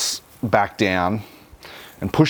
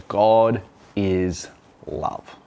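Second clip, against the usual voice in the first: solemn slow quote; much slower than usual. Real, not acted. Quoting scripture slowly.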